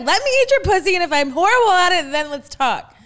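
loud, high-pitched protest; defiant comeback. Acted. Host playing the defiant partner who refuses direction, protesting. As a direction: argue back high and loud.

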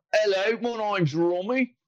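high, put-on Cockney voice; British accent attempt. Host trying guest's South African accent, landing on Cockney. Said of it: "That's, that's Cockney".

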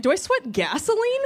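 high, alarmed question; horrified. Retold or imitated. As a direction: ask it in sudden horror. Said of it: "I'm like".

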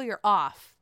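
ordinary talk, nothing marked

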